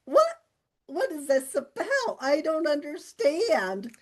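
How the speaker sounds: mock-bewildered, retold; baffled. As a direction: act out your own confused bewilderment. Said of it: "I'm there like".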